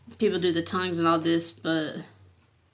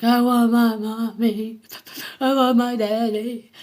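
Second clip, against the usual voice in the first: put-on high, loud childish wail for mommy and daddy; crying little kid; 5 semitones higher, 6 dB louder than usual. Man recalling a crying child's plea, louder and higher childish wail. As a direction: mimic a scared kid sobbing for his parents. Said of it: "a little kid when they're in trouble and they start cry".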